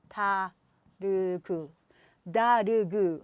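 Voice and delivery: 다르그 slowly in three broken syllables; 다르그 split into three slow beats; wrong syllables. Shown as a demonstration. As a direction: mimic a foreigner saying 다르그 syllable by syllable. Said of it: "다르그가 뭐지".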